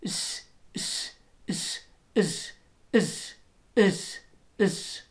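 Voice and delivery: a run of s sounds sliding forward; S toward slender R. Shown as a demonstration. Teacher linking the S to the Gaelic slender R. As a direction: move the s forward bit by bit. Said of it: "A similar thing happens with a Gaelic slender R".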